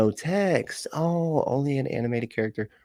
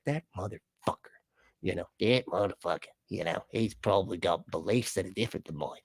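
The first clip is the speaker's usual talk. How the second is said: put-on softer, muttered Southern accent gone wrong; botched Southern accent; 3 semitones lower, 8 dB quieter than usual. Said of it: "trying to do a Southern accent".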